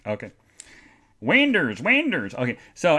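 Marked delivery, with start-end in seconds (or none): 1.2-2.3 "Winders" for windows, ending in -ers, pitched high; high, playful "WIN-ders"; folksy "Winders". Shown as a demonstration. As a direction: exaggerate "Winders" with a raised voice. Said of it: "let's say Winders".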